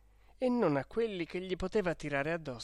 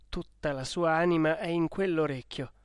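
ordinary delivery

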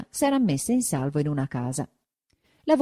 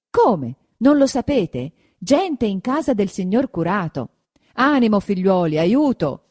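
loud, raised cry to rally people; urgent call for help; 4 semitones higher, 6 dB louder than usual. A narrator's reading. Sexton to villagers about intruders at the curate's, urgent call. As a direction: rouse the villagers, louder and urgent.

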